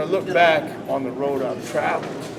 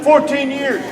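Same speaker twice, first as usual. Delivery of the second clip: raised; 6 semitones higher, slower than usual. Shown as a demonstration. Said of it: "Put inflection in your voice".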